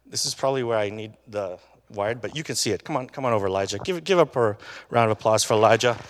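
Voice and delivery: normal talking voice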